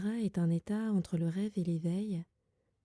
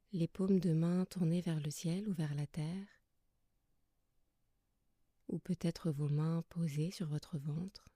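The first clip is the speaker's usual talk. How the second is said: soft, calm yoga nidra guidance with long pauses; guided meditation; 5 dB quieter than usual. Acted. Yoga teacher settling listeners lying under blankets, soft guided meditation. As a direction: guide them gently into rest, leaving long silences between phrases.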